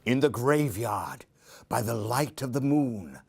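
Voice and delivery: plain reading voice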